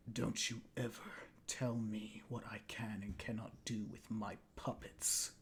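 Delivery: low threatening whisper; menacing whisper. Acted. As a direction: hiss it low and quiet like a threat. Puppet master threatens through Kyle.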